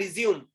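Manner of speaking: resume as "ri-zyoom", two syllables; verb resume. Shown as a demonstration. Teacher explaining the verb meaning continue, resume as "ri-zyoom". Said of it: "To continue".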